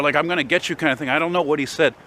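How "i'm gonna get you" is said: normal talking voice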